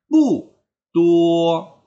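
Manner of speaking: "pu" loud, high and falling, "tuo" held level; fourth tone then first. Shown as a demonstration. Teacher modelling the fix, "pu" falling before level "tuo". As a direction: punch "pu" down, hold "tuo" high. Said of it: "bacanya nada keempat".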